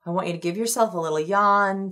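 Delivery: ordinary talk, nothing marked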